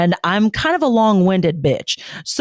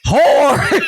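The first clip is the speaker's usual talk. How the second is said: loud proclamation; 8 semitones higher, 5 dB louder than usual. Pretend. Joining the mock proclamation.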